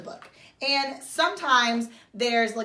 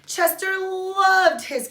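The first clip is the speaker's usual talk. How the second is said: delighted; 9 semitones higher, 6 dB louder, much slower than usual. A narrator's reading. Story climax line.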